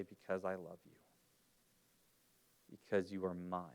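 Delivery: put-on a father reassuring his child; God's tender voice. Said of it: "he will say".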